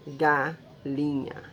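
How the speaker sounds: "Galinha" slow, with breaks between syllables. Shown as a demonstration. Teacher showing where to divide, "Galinha" slowly syllabified. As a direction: split "Galinha" at its syllable boundaries. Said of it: "só posso separar entre uma e outra".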